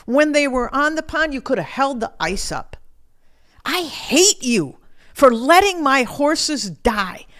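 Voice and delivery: high, loud, anguished accusation; screaming at God. Retold or imitated. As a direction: yell the blame at God for the loss. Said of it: "I just kept screaming at him".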